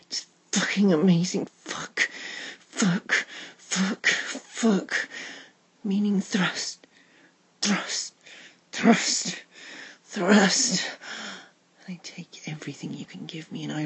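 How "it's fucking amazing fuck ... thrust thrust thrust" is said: loud, urgent whispered chant; breathless excited whisper. Acted. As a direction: build the urgency with each word. Climax build in roleplay.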